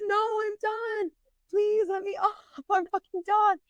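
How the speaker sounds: very high, tearful-sounding mock plea; acted-out desperate begging. Retold begging to get off.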